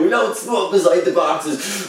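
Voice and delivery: slow, mock-proper scolding; mock prim warning. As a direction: drag it out like a prissy supervisor. Mock rule in a put-on voice.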